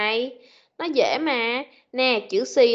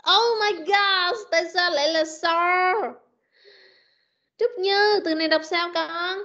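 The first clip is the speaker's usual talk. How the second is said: exasperated; 6 semitones higher than usual. Real, not acted. Teacher fed up with the pupil's mistake, exasperated. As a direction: let the frustration lift the voice. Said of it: "exasperated teacher".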